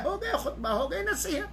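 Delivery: high, soft laughing wrap-up; amused aside. Real, not acted. Teacher shows how easy a khutbah is, softly laughing. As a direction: chuckle through it, lightly, as if it's that simple. Wrapping up his easy sample sermon.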